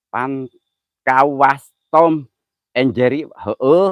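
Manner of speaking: drawn-out, slow comic phrasing; slow teasing comparison; slow drawl. Real, not acted. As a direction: stretch it out slowly for a laugh. Jokey comparison.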